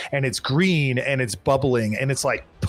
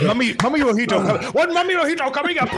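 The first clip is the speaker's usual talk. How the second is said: laughing bartender; 11 semitones higher, much faster than usual. Acted. Bartender laughs, serves drink.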